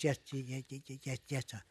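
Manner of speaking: stammering yes, sir softly. Retold or imitated. Truck driver stammering at the copper. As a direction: stutter nervously over the yes.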